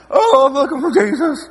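hammed-up weeping woman, half laughing; tearful Mary. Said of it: "And she".

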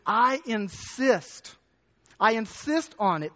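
"I insist" drawn out very slowly; insistent emphasis. Shown as a demonstration. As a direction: lean hard on "insist". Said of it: "this word insist".

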